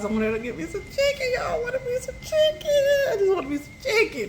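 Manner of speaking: high, whiny mock sob over chicken; mock crying whine. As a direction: whimper it like a pregnant woman denied her food. Pregnant craving, mock whining.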